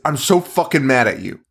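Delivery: ordinary talk, nothing marked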